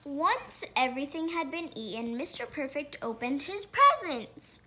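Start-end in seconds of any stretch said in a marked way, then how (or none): none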